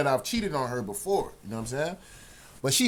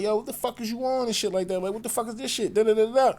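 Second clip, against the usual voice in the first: high heated confrontation; 8 semitones higher than usual. Retold or imitated. Confronting girlfriend outside.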